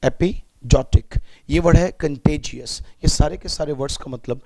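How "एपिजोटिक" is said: एपिजोटिक drawn out slowly, syllable by syllable; teacher modelling एपिजोटिक; slow pronunciation. Shown as a demonstration. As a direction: spell out एपिजोटिक slowly for students. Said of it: "ये होता है एपिजोटिक".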